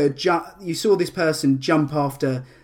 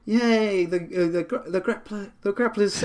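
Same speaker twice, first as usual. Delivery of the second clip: mock crowd cheer, higher pitched without real volume; cheering crowd shout; 5 semitones higher than usual. Game master voices townsfolk hailing the grappler, mock cheering shout. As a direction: imitate excited onlookers in a raised pitch. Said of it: "lots of people shout".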